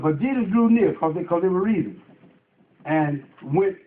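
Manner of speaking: ordinary delivery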